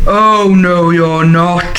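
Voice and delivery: mildly roared troll refusal; understated troll roar. A narrator's reading. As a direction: roar it lightly like a storybook troll. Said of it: "roared the troll".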